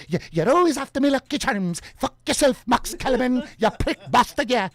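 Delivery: put-on loud, angry Irish accent, high. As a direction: rant at him in a thick Irish accent. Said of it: "your best Irish accent".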